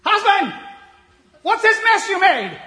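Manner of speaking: shouted high and loud scolding; angry shrill wife. Acted. Wife scolding husband over the mess, loud and high. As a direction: yell at him like an angry wife.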